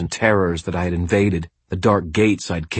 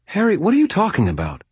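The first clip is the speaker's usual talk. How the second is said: high-pitched, baffled question; alarmed bewilderment; 13 semitones higher, faster than usual. A narrator's reading. Friend questioning the babbling wizard at her door, alarmed bewilderment. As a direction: ask it high, thrown by his babble.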